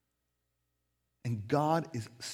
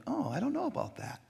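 doubtful hedge; 10 semitones higher, 5 dB quieter than usual. Retold or imitated. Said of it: "and you're like".

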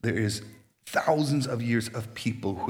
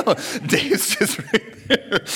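much higher, slow, laughing through the words; laughing surprise; 11 semitones higher, 4 dB louder, slower than usual. Retold or imitated. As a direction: caught off guard and giggling. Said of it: "I'm like".

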